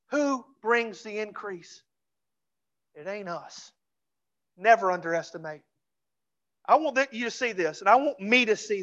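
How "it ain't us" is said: ordinary delivery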